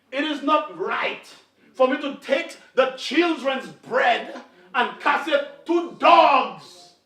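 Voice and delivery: slow, building to a loud final word; stern slow pronouncement. Acted. Yeshua answering the woman, slow and stern. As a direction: lay down the hard truth.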